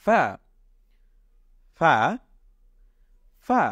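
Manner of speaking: ফা with a light breath between teeth and lip; repeated fa drill. Shown as a demonstration. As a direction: drill ফা slowly, air escaping. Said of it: "আমরা আবারও পড়ছি". (দাঁত-ঠোঁটে হালকা বাতাসে ফা)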